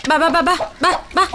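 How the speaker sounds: sing-song coaxing call to hens. Real, not acted. Woman calling her hens in the rain, sing-song coaxing. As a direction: coax the chickens over, repeating the call.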